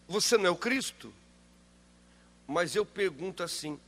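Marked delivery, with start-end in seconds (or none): 0.1-1.1 loud, sneering taunt, higher; mocking thief. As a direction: throw the question back mockingly. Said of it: "o que esse homem disse".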